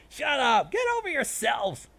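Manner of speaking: high, loud snapping comeback; shouted retort. A narrator's reading. Pony silencing rival hecklers, high loud shout. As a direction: yell to shut them down.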